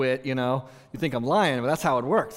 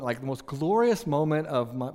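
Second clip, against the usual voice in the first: usual speaking voice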